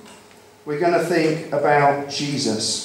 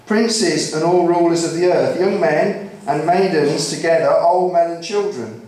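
raised, rhythmic scripture reading; psalm proclamation; 3 semitones higher, 3 dB louder than usual. Real, not acted. Preacher reads Psalm 148 to the congregation, proclaiming. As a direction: call all creation to praise, line by line.

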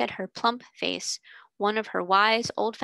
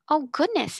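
higher-pitched, mild amazement; astonished exclamation; 7 semitones higher than usual. A narrator's reading. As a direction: exclaim in wonder without shouting. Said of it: "exclaimed Ermengarde".

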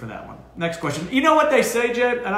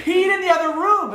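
high, louder whiny complaint; complaining owner; 13 semitones higher, 4 dB louder than usual. Retold or imitated. Owner grumbling that the dog peed in the other room, whiny. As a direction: whine it like the fed-up owner.

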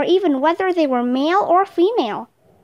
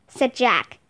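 ordinary delivery

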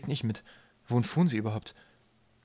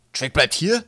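loud, high, startled question; surprised; 6 semitones higher, 9 dB louder, slower than usual. A narrator's reading. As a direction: ask it taken aback, pitch jumping up. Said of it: "fragte Tim Waterbock überrascht".

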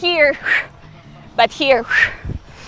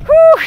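sudden high whoop, shouted; loud high whoop; 8 dB louder than usual. Real, not acted. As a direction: let out a high "whew" of effort. Exertion during arms-up squats.

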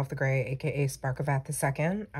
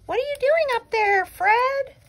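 very high, loud cartoon squeak; snail voice; 7 dB louder than usual. One snail calling to another up above, squeaky snail voice. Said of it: "now the snails are having a whole conversation".

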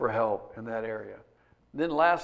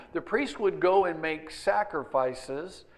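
ordinary talk, nothing marked

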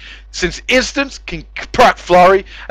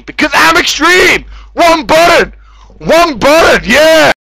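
high, loud bragging; hammed-up gamer boast; cocky shout; 7 semitones higher, 4 dB louder than usual. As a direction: yell it like a victory cry.

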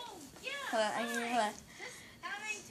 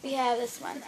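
whispering; 2 semitones lower, 10 dB quieter than usual. Shown as a demonstration. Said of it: "the backwards whisper".

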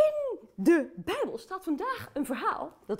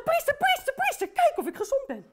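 eager call; 10 semitones higher than usual. Acted. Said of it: "Ze zeggen".

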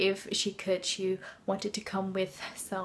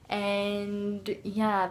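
ordinary delivery

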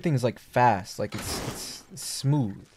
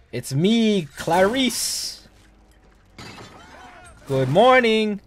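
high, creepy sing-song greeting; a high, eerie creature voice; 8 semitones higher, 4 dB louder, slower than usual. Pretend.

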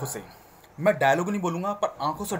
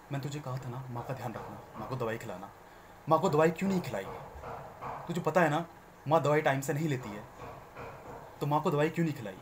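loving anger; 4 dB quieter than usual. Shown as a demonstration. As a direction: scold him but keep the love in it. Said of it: "प्यार भी रहना चाहिए और गुस्सा भी". (प्यार भरा गुस्सा, धीमी डांट)

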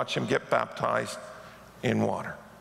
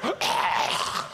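sudden gasp; 7 semitones higher than usual. Retold or imitated. Dead husband suddenly revives, dramatic mock gasp. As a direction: suck in air like a corpse reviving. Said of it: "the guy goes".

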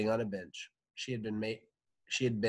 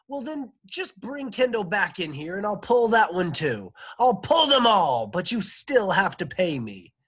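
angry phone rant; 11 semitones higher, 12 dB louder than usual. Acted. Dentist rants at mother on phone.

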